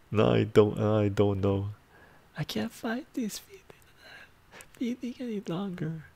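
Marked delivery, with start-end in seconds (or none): 2.4-6.0 whispered falsetto, much higher and softer; failed falsetto try at the song line; high falsetto attempt. Shown as a demonstration. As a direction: strain for the high note on a whisper. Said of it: "I can't even do it".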